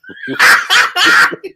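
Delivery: squealing high laugh; helpless high laugh; high burst of laughter. Real, not acted. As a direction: break up laughing. Laughing at the story.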